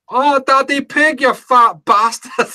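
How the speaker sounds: high, loud, drawn-out Scottish cartoon pig; Scottish parody Peppa Pig. Peppa scolding Daddy Pig, Scottish parody voice. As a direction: squeal it slowly in a mock Scottish accent. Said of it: "the Scottish version of Peppa Pig".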